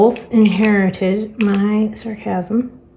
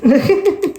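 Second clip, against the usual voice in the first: high breathy laugh; airy giggle; 8 semitones higher than usual. Real, not acted. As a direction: let out a light laugh. Laughing after the story.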